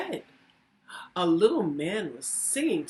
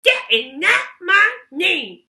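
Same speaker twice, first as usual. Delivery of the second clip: goblin voice; 12 semitones higher, 12 dB louder than usual. Acted. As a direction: bellow it like a gleeful goblin. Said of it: "smiled the goblin".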